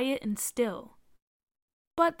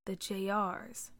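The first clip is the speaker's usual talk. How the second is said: to himself; 2 semitones lower, 8 dB quieter, slower than usual. A narrator's reading. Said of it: "Car said to himself".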